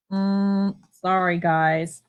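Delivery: normal talking voice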